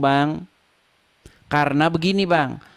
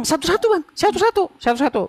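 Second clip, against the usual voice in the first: insistent; 10 semitones higher, faster than usual. Real, not acted. Insisting on one at a time.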